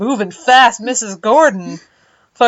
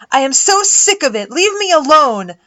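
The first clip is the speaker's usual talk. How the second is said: angry shout; 7 semitones higher, 5 dB louder than usual. Acted. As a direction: scream it through tears. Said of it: "as I shouted across the hallway".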